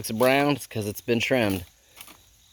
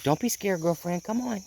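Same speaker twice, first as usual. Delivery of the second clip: higher, softish coaxing animal voice; reassuring rooster voice; 8 semitones higher, 4 dB quieter than usual. Retold or imitated. Owner voices rooster reassuring scared hen, lightly coaxing. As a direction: play a bird gently coaxing his hen. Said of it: "He's talking to her".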